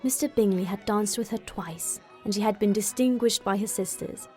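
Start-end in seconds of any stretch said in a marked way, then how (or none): none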